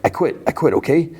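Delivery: high-pitched mock pleading; retold panicked surrender. Beaten gang leader facing the scorpion's tail, mock panicked surrender. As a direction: give up fast, voice jumping up.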